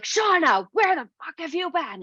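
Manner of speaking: high-pitched mock scolding; exasperated mom. Mom scolding daughter on phone, retold.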